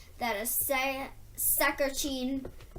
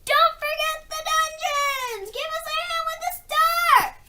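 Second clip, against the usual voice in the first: high excited shouts from the dungeons; high, loud and fast, calling across the castle; 6 dB louder than usual. A narrator's reading. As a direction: call out eagerly through the castle. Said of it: "crying out at once".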